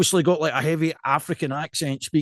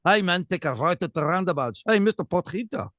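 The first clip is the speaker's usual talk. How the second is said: imitated South African accent. Showing off to co-host, mock South African accent. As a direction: put on a South African greeting voice. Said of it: "I think I've got that off".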